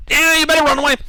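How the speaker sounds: very high, loud goblin jeer; squeaky creature taunt. Acted. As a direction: repeat the shrill goblin taunt. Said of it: "He said".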